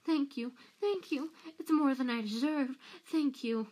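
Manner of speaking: high, fawning gratitude; grovelling Peter. A narrator's reading. Peter gushes thanks to Harry.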